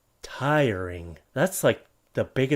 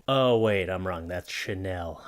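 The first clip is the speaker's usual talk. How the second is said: usual speaking voice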